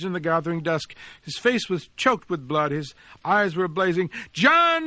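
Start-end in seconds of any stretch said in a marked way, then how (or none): 4.3-4.9 a high, loud cry across the street; boy hurling a friend's name in fury; cried out. Acted. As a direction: fling the name at his enemy. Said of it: "he cried".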